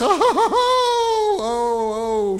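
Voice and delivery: high drawn-out comic moan, sliding down; exaggerated "oh, oh" of stiff joints; mock pained groans. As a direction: ham up the first achy step. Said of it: "When I step down, it's".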